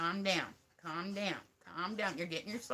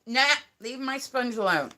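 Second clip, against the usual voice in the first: loud, higher-pitched telling-off; sharp pet reprimand; scolding the puppy; 5 semitones higher, 10 dB louder than usual. Real, not acted. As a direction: call the dog off, loud and sudden. Calls puppy off the sponge.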